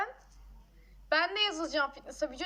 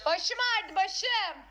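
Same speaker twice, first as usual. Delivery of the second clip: whiny complaint; 4 semitones higher, much slower than usual. Real, not acted. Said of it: "başım ağrıyor".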